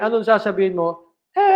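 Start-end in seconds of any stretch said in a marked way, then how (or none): 1.4-1.5 long, high drawn-out "eh" wail; mock falling scream. Teacher jokes about falling from a building, mock falling scream. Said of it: "Pag nahulog ka sa isang building".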